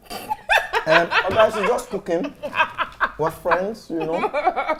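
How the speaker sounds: high, flustered laughing talk; laughing embarrassed. Real, not acted. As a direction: laugh it off awkwardly. Flustered after boyfriend reply.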